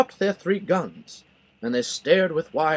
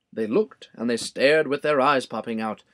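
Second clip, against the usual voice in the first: plain reading voice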